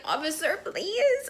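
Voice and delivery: high, pleading whine on please. Retold or imitated. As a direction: beg the cop sweetly. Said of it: "I'm trying to plead with the officer".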